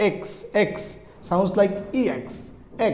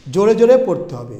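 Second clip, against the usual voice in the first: louder, brisk and insistent; loud reading; 5 dB louder, faster than usual. Shown as a demonstration. Teacher tells students how to read, loud and brisk. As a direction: push the words out loudly.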